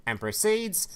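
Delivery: usual speaking voice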